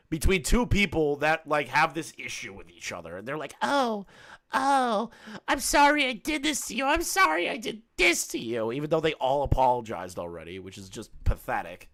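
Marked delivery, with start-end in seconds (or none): 3.6-8.7 high whiny mocking apology. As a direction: whine it out like a fake apology.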